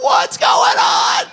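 high, slow startled cry; alarmed exclaim. Retold or imitated. Pastor reliving spotting odd iguana, startled high cry. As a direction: react to a strange iguana, alarmed. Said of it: "And I'm like".

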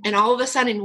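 normal talking voice

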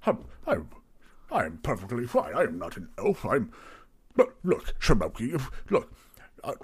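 halting, polite protest; mildly flustered innkeeper pleading innocence; defensive tavern keeper. Acted. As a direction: protest harmlessness to a suspicious customer. Plays Barry, flustered tavern keeper.

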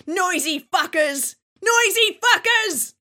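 high, loud, drawn-out yell; furious shout. Acted. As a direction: shout it slowly at the neighbours. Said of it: "and shouts".

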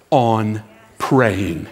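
low, deliberate, word by word; emphatic exhortation. Real, not acted. Preacher encouraging long-time pray-ers, slow emphatic exhortation. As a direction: urge it firmly with weighty pauses.

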